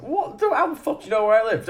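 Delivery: high-pitched, quick mock disbelief; startled retort. Dave answers Chuck's midnight call, startled and incredulous. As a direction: imitate a man startled and baffled, voice shooting up.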